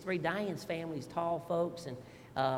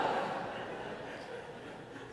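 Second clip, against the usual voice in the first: a soft breathy laugh; quiet laugh; 9 dB quieter than usual. Real, not acted. Pastor laughing at his own joke, soft laugh. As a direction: chuckle at his own story.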